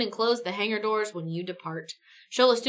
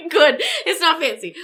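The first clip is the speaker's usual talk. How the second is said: giggly reply; 6 semitones higher, 7 dB louder than usual. Real, not acted. Laughing reply.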